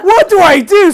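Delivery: high, loud, laughing panic; panicked laugh. Retold or imitated. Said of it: "I'm like".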